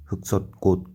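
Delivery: usual speaking voice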